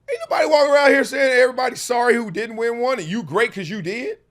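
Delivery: loud, fast, heated rant, voice cracking into a laugh; heated shout. Real, not acted. Podcast host shouting down the championship argument, fast and loud. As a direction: fire it out fast and loud like the point is obvious.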